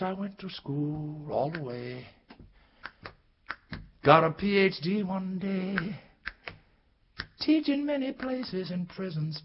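ordinary delivery, nothing marked

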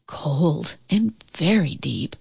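usual speaking voice

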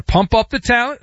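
lightly voiced Jerry Jones hype; imitating Jerry Jones. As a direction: pump it up like Jerry. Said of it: "That's a Jerry's way".

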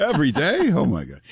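high, teasing disbelief; a playful, astonished echo; surprised. Real, not acted. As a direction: push the pitch up again, amazed. Mock disbelief at daily podcast.

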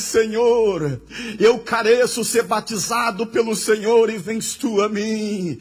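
loud, fervent plea to the Lord; preacher voicing a humbled prophet in full cry; John the Baptist pleading. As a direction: plead urgently at pulpit volume. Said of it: "E João Batista diz".

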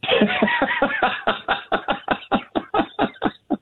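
long, high-pitched cackling laugh; gleeful laugh. Real, not acted. Host delighted at seeing bluffs called, gleeful high laughter. Delighted by opponents being mocked.

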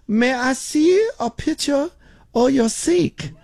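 put-on high, slow Filipino-accented woman's voice; imitated Filipino accent. Phone agent asking to see the sink, imitated Filipino accent. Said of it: "some woman in the Philippines".

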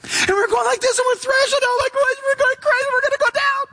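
high, strained panicked wail; screaming emotions. Acted. Preacher voicing our emotions going crazy, panicked screaming. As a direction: act out thrashing, hysterical panic. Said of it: "the throne of your emotions screaming at you".

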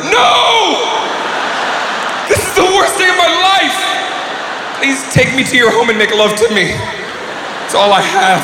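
mock despair. Man faking a burned house to his date, hammed-up high wail. Fake tragedy plea.

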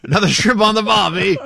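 loud, high hammed-up Aussie; cartoon Australian, over the top; Australian accent. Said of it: "What accent was that?".

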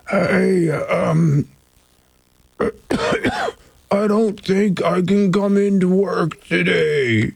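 slow, croaky fake sick voice. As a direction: pretend to call in sick. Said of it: "set us talkbacks".

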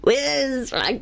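slow, put-on Scottish accent; drawn-out Scottish lilt; a weak stab at a Highland Scot. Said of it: "Say, "Where is my kilt?".

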